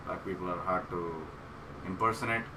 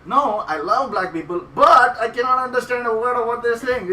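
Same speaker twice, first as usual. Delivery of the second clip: high, loud comic rebuttal; loud protest; 12 semitones higher, 10 dB louder than usual. Retold or imitated. Comic answering a woman who called out, loud protest. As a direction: shout back over the room.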